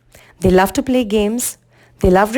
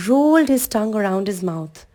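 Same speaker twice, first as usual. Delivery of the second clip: ordinary delivery, nothing marked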